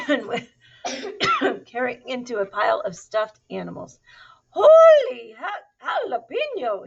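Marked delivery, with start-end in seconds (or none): 4.5-6.9 high, loud, drawn-out exclamation; exclaiming. A narrator's reading. Kitten crashing into stuffed animals, exclaiming. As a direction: cry out in cartoonish amazement. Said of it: "exclaimed Skippy John Jones".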